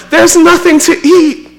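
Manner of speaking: high-pitched whining child, hammed-up; whiny kid. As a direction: play a kid whining into the cupboard. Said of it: "my kids have told me so many times".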